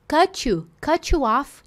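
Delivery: "cut you off" said "cutchu off", T and you fused into tch; fuse "cut you" into tch, playfully; "Cut you" sounded as "cutchu"; cutchu linking. Shown as a demonstration. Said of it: "tchutchucão".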